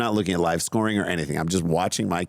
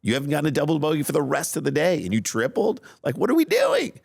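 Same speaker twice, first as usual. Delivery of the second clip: rapid and pitched high, breaking into a near-cry on the last question; retold exasperated rant; 8 semitones higher, faster than usual. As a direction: fire off the complaints fast, then crack with exasperation. Parent retells venting at daughter.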